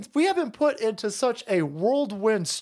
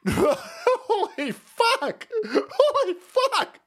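high, slow, laughing through the words; stunned laughing holy fuck; laughing disbelief; 10 semitones higher, slower than usual. Real, not acted. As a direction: laugh it out in shock.

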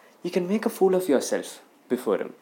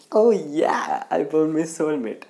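mock delight; 6 dB louder, slower than usual. Acting delight at finding the puppy.

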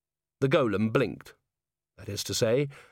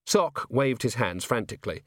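plain reading voice

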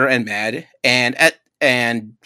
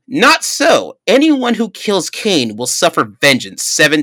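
God refusing; 5 semitones higher, 4 dB louder than usual. Retold or imitated. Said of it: "the Lord said to him".

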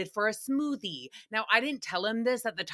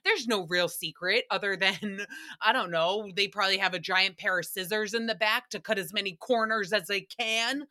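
normal talking voice

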